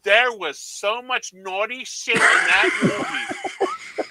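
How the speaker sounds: slow, high, laughing scandalized lady; mock scandalized mom. The mom tells the clerk the movie was naughty, slow and high. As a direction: drag out her shocked report, laughing. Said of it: "she goes".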